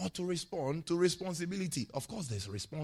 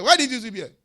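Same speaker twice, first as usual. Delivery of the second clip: higher, much louder scolding shout; mock angry shout; 8 semitones higher, 16 dB louder than usual. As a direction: yell it like a fed-up pastor. Acting angry pastor shouting.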